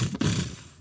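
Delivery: soft, breath-only puffs for gunfire; imitated gunshots. Host recalling the reenactment's flashes, imitated muffled gunshots. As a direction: puff out two muted gunshot noises. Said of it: "you just see the flashes and it's like".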